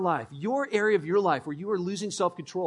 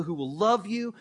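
ordinary delivery

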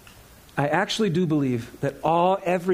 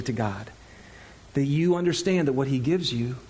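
ordinary delivery

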